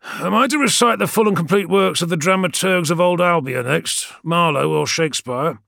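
high, loud, exasperated sarcasm; angry. A narrator's reading. Sergeant fed up with reciting to the warrior, angry. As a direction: fire it off through gritted teeth. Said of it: "angry breaths soaring through his gritted teeth".

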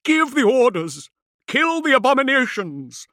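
high screamed orders, rasping with panic; frantic, loud, high-pitched rasp; rasping hysteria. A narrator's reading. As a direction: shriek the commands hysterically. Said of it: "his voice rasping with hysteria".

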